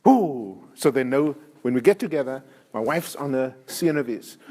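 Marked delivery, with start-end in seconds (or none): none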